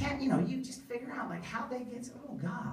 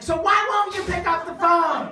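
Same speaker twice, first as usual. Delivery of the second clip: mock guard voice; 9 semitones higher, 8 dB louder than usual.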